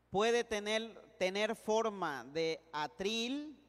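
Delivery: slow, measured teacher's dictation. Real, not acted. Teacher giving the class a definition to copy, slow deliberate dictation. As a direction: dictate phrase by phrase, leaving room to write.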